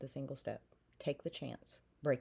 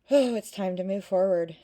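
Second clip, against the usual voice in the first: a sudden loud burst of encouragement; loud urging; 3 semitones higher, 11 dB louder than usual. Real, not acted. Tarot reader urging a viewer forward, loudly. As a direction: call it out, pushing them onward.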